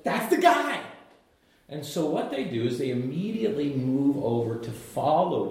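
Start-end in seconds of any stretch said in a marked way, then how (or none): 0.0-0.8 high, excited proclamation; excited announcement. Acted. Preacher as John the Baptist pointing out Jesus, excited and high. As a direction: point him out with mock-urgent excitement.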